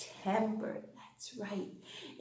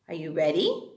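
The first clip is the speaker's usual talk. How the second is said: usual speaking voice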